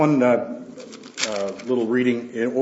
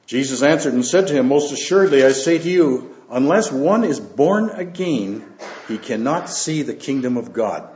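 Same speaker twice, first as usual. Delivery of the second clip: raised, measured Bible reading voice; scripture reading; 3 semitones higher, 5 dB louder than usual. Acted. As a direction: read the Gospel out to the church with weight. Reads scripture aloud to congregation.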